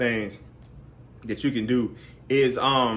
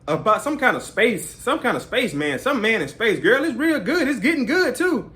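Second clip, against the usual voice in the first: mother on the phone; 11 semitones higher than usual. Retold or imitated.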